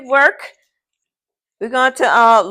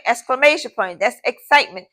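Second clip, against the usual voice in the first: ordinary talk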